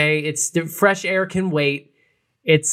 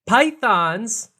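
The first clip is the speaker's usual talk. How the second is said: dramatic reveal; 3 semitones higher, slower than usual. Real, not acted. Telling co-host what was behind the microwave, dramatic reveal. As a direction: spell out the absurd find slowly, piece by piece.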